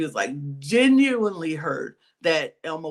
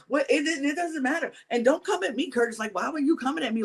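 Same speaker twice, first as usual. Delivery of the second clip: defensive; 7 semitones higher, faster than usual. Retold or imitated. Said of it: "she was like".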